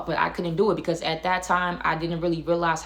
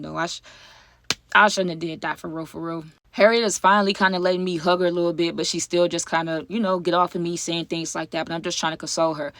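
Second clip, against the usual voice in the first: ordinary delivery, nothing marked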